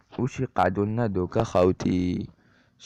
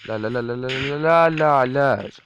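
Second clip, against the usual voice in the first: desperate no; 5 semitones higher, 6 dB louder than usual. Real, not acted. Creeper blast, falls in hole.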